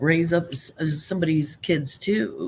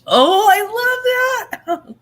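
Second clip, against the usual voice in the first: much higher, louder and slow, delighted; 7 dB louder, much slower than usual. Real, not acted. Host delighted by the Mummy English nickname, gushing. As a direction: gush over it.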